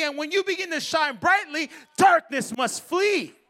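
loud, rising preacher's shout; preaching at full force. Acted. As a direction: build the crowd up to a roar.

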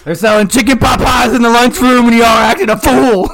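loud, high exasperated yell; exasperated shout. Pretend. Pretend inmate scolds fighting inmates: high loud yell. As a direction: scold the whole room at full voice.

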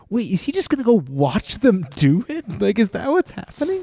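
rising, baffled disbelief; incredulous. Retold or imitated. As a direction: ask it like you can't believe it. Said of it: "I said out loud".

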